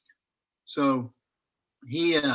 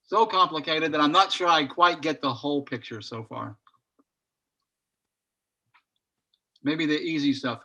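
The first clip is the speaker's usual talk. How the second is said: talking while chewing; words around a bite of protein bar; mouth full. Real, not acted. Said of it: "I'm chewing on a protein bar".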